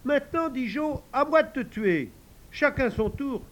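high pleading demand; insistent child. Acted. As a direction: claim your turn. Joe demands his turn.

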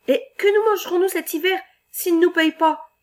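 anxious protest. Acted. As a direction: cry out about hunger. Worried lumberjack protesting.

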